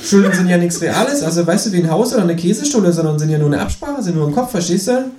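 high, cheeky kangaroo voice. Acted. Kangaroo asks narrator to shove over, high cheeky. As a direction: pitch way up for the cheeky marsupial.

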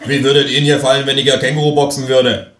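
threatening thug. Acted.

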